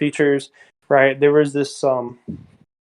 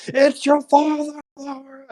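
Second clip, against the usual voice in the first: high, drawn-out hammed-up movie scream; mock scream; 12 semitones higher than usual. Imitating a character screaming at Laura, mock scream. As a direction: imitate an anguished scream without real volume. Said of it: "He's screaming at her".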